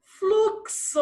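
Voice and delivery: "Fluxo" high, with a marked ks. Shown as a demonstration. Teacher pointing pupils to the little sound, "Fluxo" with clear ks. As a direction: split "Fluxo" into fluk-so. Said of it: "Ó o sonzinho. Ks".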